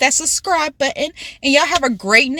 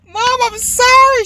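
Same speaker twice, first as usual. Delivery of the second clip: pleading apology; 12 semitones higher, 5 dB louder than usual. Retold or imitated. Daughter apologizing through laughter, retold.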